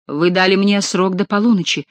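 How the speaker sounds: usual speaking voice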